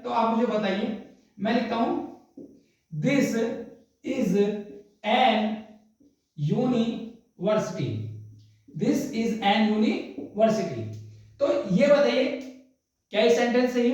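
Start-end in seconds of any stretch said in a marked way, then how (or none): 2.9-7.9 word by word, long gaps between words; measured, separated words for note-taking; slow dictation. Shown as a demonstration. Dictates while writing on board. 8.7-10.8 drawn-out, careful read-back with a break inside university; slow classroom dictation. Shown as a demonstration. Reads back the written sentence.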